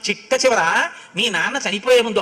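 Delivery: ordinary talk, nothing marked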